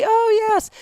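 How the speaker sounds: hammed-up fake delight at remembering; fake recognition. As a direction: pretend you suddenly remember. Fake recognition in retold scene.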